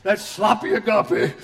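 a hint of a scolding churchgoer; mock accuser. Critic accusing [name] of greasy grace, mild mock scold. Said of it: "I've been accused of all that junk".

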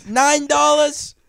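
high, loud ballpark vendor voice; stadium vendor hawking. Pretend. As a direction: pitch sodas like a hawker. Mock stadium vendor pitch.

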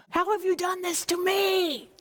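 high-pitched, wounded accusation; anguished reproach. Acted. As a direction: wail it as the wronged father. Jacob reproaches his sons.

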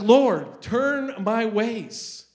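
loud opening, long high wail of appeal; retold screaming prayer. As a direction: act out a drawn-out plea shouted to God. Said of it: "I literally screaming in my car".

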